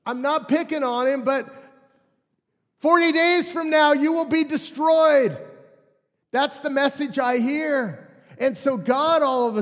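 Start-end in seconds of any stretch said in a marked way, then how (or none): 2.8-5.4 shouting judgment through a megaphone; megaphone man. Retold or imitated. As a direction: boom it like a street preacher. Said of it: "the megaphone man".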